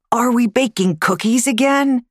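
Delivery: put-on high, bright child's voice for Lance; a keen little boy hoping for cookies; eager little boy. Boy asks about baking cookies.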